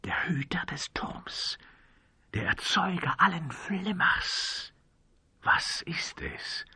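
slow, awed whisper; drawn-out hushed dread; fearful whisper. A narrator's reading. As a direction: whisper it slowly, as if afraid to name it. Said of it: "flüsterte der Mann in Schwarz ängstlich".

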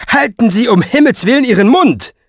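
loud, high, exasperated shout; furious plea for silence; thundering outburst. A narrator's reading. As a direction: bellow to shut someone up. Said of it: "donnerte Fenoglio".

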